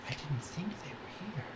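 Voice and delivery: hushed, whispered gossip; overheard whisper. Pretend. Game master voices gossip overheard in the crowd, whispered. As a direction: whisper it like half-caught chatter.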